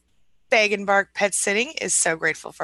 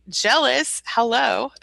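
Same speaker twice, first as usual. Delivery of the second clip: playful jealousy; 5 semitones higher, 4 dB louder, slower than usual. Real, not acted. Reacting to a viewer in Fort Lauderdale.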